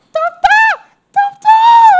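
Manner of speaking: high loud shout in mock Korean. Mouse trying Korean, shouting high and loud in mock Korean. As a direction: yell it like a Korean tourist. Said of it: "nag-Koreano siya".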